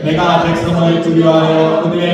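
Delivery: slow, measured scripture recitation; scripture reading. A narrator's reading. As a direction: read the verse out slowly, as if leading a congregation. Scripture read aloud in unison. (성경 봉독하듯 느리고 또박또박)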